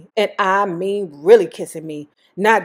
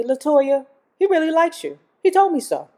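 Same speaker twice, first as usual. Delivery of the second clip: high Michael voice; 8 semitones higher than usual. Retold or imitated. Imitates Michael's voice.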